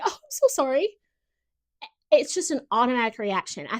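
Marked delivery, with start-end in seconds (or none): 0.0-0.9 high, apologetic little voice; imitating her own reflex sorry, pitched up; reflex apology. Imitating herself apologizing to a stranger on the sidewalk, high and sorry. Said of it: "and I'll be like".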